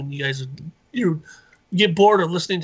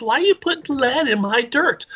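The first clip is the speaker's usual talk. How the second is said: higher, louder indignant demand; indignant uncle; 9 semitones higher, 4 dB louder than usual. Retold or imitated. Uncle demanding why lead is in his dirt, loud and higher. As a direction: throw the question at him like an outraged uncle.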